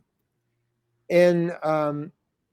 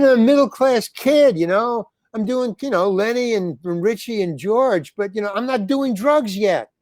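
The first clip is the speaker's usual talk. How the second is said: exasperated protest; 6 semitones higher than usual. Retold or imitated. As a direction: vent it like a wronged kid pleading his innocence. Complaining to manager about being arrested.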